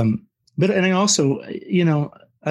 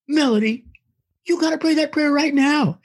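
urgent pleading. Retold or imitated. Keith urging wife to pray.

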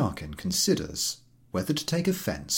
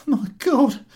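high loud moan; 9 dB louder than usual. Acted. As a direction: groan it out in misery. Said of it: "moans the scraggy-looking man".

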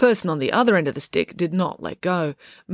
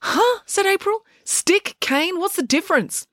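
much higher, puzzled; confused girl questioning Joe much higher; baffled; 13 semitones higher than usual. Acted. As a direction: she can't see the difference. April baffled by the cane.

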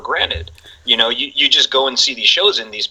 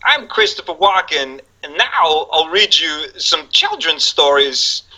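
higher, slow Walken cadence; Walken impression; 6 semitones higher, slower than usual. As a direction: imitate Walken introducing a story slowly. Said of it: "he's like".

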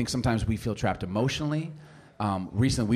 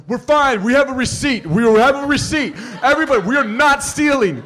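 high, loud protest to a whole crowd; hammed-up cry of alarm; mock panicked shouting; 11 semitones higher, 9 dB louder than usual. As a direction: imitate a frantic kid pleading innocence. Acted son protests as alarm blares.

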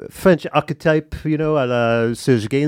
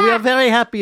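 mock cheerful Americans; 9 semitones higher, 4 dB louder, faster than usual. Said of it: "you should be like Americans".

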